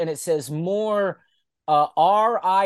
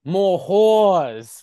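slow, drawn-out mock Russo decree; hammed-up Russo; 3 semitones higher, 5 dB louder, much slower than usual. Russo spray-painting his demand, hammed-up. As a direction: lay down the law like a smug booker. Russo voiced in retold bit.

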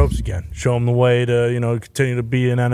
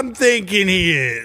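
fake enthusiasm; 6 semitones higher than usual. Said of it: "your fake enthusiasm?".

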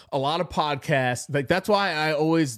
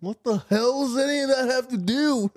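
high, hammed-up movie quote; Lebowski quote; 10 semitones higher than usual. Brothers trading Lebowski lines, high hammed-up quote. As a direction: bark the Lebowski line.

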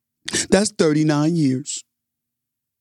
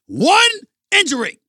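One injury, shouted high and loud; rising shout on One injury; loud emphasis; 6 dB louder than usual. Real, not acted.

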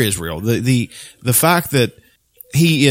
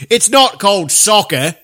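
high, slow mock British accent; prissy hammed-up British correction; 9 semitones higher, 4 dB louder, slower than usual. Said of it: "Say it like a stereotypical British guy".

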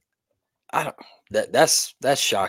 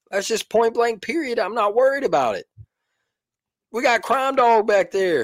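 excited boast; 10 semitones higher than usual. Real, not acted. Boasting about secondary.

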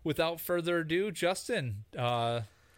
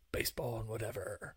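lightly imitated low-key show host, trailing off softer; a hint of an absent co-host's intro, lower and subdued; Greg impression; 4 semitones lower, 8 dB quieter than usual. Co-host imitating absent colleague's segment intro, low-key impression. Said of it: "that was your best Greg impression".

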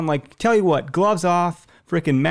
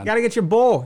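high, badly faked Scottish accent; Boston attempt sliding into mock Scottish; botched Boston accent turned Scottish; 7 semitones higher than usual. Joker loses his Boston accent, drifting into Scottish. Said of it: "I'm now doing Scottish".

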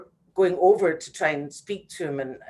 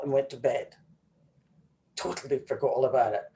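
ordinary talk

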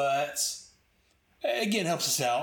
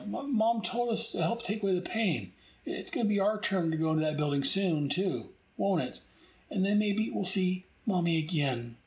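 put-on high, childlike voice, mildly tearful; little girl voice; 6 semitones higher than usual. Little girl tells the captain about the building, childlike voice. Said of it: "The little girl's like".

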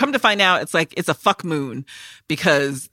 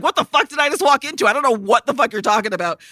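baffled Loretta; 6 semitones higher, faster than usual. Retold or imitated. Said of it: "Loretta's like".

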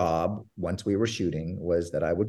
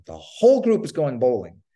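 louder, eager reenacted announcement; retold proud announcement; 3 semitones higher, 5 dB louder than usual. Producer recalls telling George about the outing, proudly excited. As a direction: announce it like your own big achievement.

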